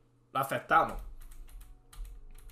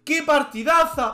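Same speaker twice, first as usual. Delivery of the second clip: high, loud, slow exclamation; exultant cry; 8 semitones higher, 7 dB louder, slower than usual. Real, not acted. Streamer glorying in his own game, exultant cry. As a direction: belt it out, stretching the words. Repeats it louder and higher.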